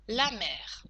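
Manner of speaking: measured teacher dictating in short chunks; careful, segmented delivery; dictation. Shown as a demonstration. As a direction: dictate for pupils writing it down, pausing between phrases. Dictates text with punctuation.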